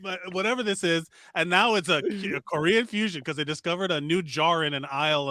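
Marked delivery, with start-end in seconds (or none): 0.0-1.1 mock Cuban chef. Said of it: "Cuban motherfucker who's like".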